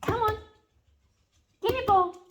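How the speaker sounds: high, squeaky pet-calling voice; guinea pig voice. Real, not acted. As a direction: squeak at the pet to get him going. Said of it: "Guinea pig noise gets him going".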